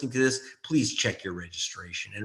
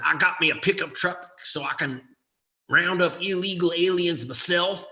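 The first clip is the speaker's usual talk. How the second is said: mocking Southern good old boy accent, slightly higher pitch; 7 semitones higher, 4 dB louder than usual. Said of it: "he fakes that Southern good old boy accent".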